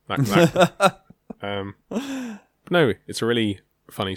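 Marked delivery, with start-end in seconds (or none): 0.1-1.0 loud, high yelled bit; shouting. Retold or imitated. Friend imitating a shouty character, loud and high. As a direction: bark it out like a shouty straight man. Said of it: "He kinda just shouts".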